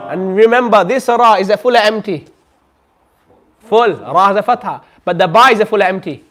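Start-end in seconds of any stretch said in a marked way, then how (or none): none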